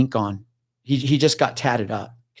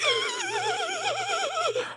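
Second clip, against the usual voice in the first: rapid high wheezy breathing; mock hyperventilating; imitated strange breathing; 9 dB quieter than usual. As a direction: pant in high, quick pulses. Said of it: "she's doing this breathing like".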